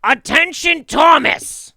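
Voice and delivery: high, very loud, drawn-out squawk; Gilbert Gottfried. Acted. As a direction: abrasive shouted announcement in a screechy put-on voice. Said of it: "like Gilbert Gottfried being like".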